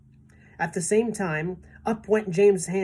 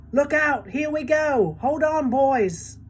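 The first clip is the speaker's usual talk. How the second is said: excited shouts; 9 semitones higher, 4 dB louder, slower than usual. A narrator's reading. Peach rising, crew shouts.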